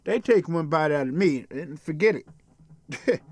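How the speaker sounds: ordinary talk, nothing marked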